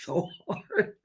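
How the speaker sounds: normal talking voice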